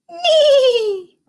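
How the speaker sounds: hammed-up horse whinny; horse neigh. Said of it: "Talk like an animal".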